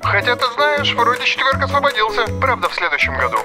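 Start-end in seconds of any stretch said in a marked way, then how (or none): 0.0-2.3 higher, hopeful phone voice; sudden brighter offer down the line; friend on the phone. Acted. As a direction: lift the pitch, offering a slot. Friend suddenly finds a free slot.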